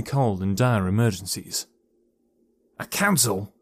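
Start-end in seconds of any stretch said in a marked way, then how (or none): none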